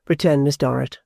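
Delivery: ordinary delivery, nothing marked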